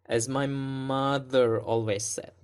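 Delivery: "mother" and "said" held longer with an up-down shape; stressed words stretched. Shown as a demonstration. Teacher models the movie line's thought group, stretching "mother" and "said". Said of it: "Mud and said a little bit longer".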